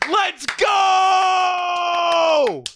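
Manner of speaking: high yell with a long held "go"; triumphant yell. Real, not acted. Fan cheers his team's pick, triumphant yelling. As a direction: stretch "go" into a victory cry.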